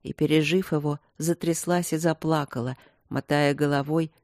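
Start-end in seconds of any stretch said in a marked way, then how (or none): none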